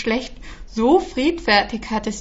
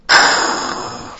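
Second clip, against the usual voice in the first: a loud rasping unvoiced krrr; dragon hiss; 4 dB louder than usual. A narrator's reading. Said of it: "fauchte der Drache".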